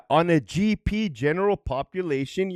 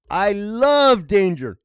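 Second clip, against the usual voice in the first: I love danger stretched slow and louder; slow loud declaration; 4 semitones higher, 6 dB louder, much slower than usual. Real, not acted. Vlogger repeating his creed, slower and louder. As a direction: lean into it like a catchphrase. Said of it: "I swore in the Bible".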